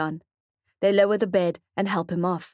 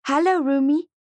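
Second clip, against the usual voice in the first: put-on higher child's voice, eager; little boy; 8 semitones higher, 5 dB louder than usual. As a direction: speak up high like a small boy. Young boy Mally greets Marco.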